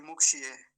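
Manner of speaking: मुक्षीय quick and loud, shi cut short; hasty short i. Shown as a demonstration. Teacher imitating careless reciters, hasty short i. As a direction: clip the long ī of मुक्षीय. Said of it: "बहुत जल्दी बोलते हैं".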